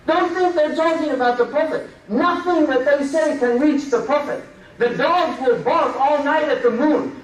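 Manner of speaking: normal talking voice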